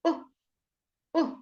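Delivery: u said very short, as in book; two clipped u vowels with a pause between; shortened u sound. Shown as a demonstration. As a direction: keep each u brief. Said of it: "u is a shortened sound".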